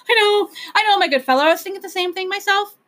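high, quick royal voice; anxious Whangdoodle. A narrator's reading. Whangdoodle answering the Proc, high and hurried. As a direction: keep it high and hurried, a worried king.

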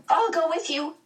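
put-on very high, urgent girl; shrill offer, pitched far up. A narrator's reading. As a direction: pipe up shrilly. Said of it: "Meg's voice was shrill".

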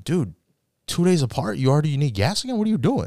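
high, incredulous questioning; you already need gas again, rising disbelief; exasperated disbelief. Retold or imitated. Narrator to friend, high exasperated disbelief. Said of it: "I'm like".

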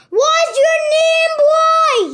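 very loud, high-pitched threat; growled demand. A narrator's reading. Captor demanding the boy's name, growled loudly. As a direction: bellow it like a menacing captor. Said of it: "he growls".

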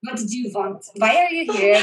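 playful put-on Russian accent. Shown as a demonstration. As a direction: question them in a mock Russian accent. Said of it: "I did a Russian one really well".

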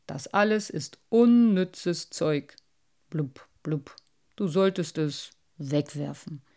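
mocking old carp with burbling blubs; a know-it-all fish looking down on the collection. Carp lectures.

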